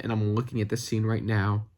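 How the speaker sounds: usual speaking voice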